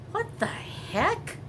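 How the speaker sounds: high, slow, baffled; surprised. Real, not acted. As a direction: let the pitch jump in disbelief. Watching neighbors carry things out.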